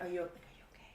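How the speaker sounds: hushed, gentle check-in, acted out; imitated whisper. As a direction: softly imitate a concerned whisper. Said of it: "Or, like, whisper it".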